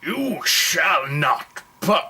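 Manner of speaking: slow, grand wizard decree, drawn out; mock wizard proclamation. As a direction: intone it like an old wizard barring the way. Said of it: "oh, that's the wrong film".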